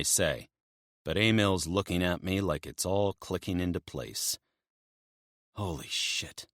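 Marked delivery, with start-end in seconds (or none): 5.6-6.5 soft, stunned breath. Acted. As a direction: barely voice it in shock. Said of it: "he breathes".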